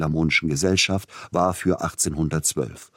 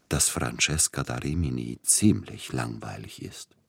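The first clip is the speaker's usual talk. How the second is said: lower, softer, insolent confidence; brazen familiarity; 3 semitones lower, 5 dB quieter than usual. A narrator's reading. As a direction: slip in the insult as a cosy secret. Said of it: "mit einer plötzlichen, höchst unverschämten Vertraulichkeit".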